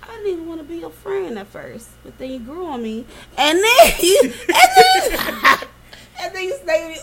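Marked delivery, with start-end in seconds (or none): 3.4-7.0 high, breaking into laughter; laughing through it. Real, not acted. Friend laughs while retelling the betrayal, high laughter. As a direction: laugh while you try to say it.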